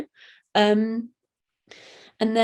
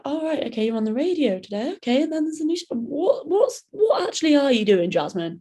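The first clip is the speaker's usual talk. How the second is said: baffled friends; 6 semitones higher than usual. Retold or imitated. People online confused quoted.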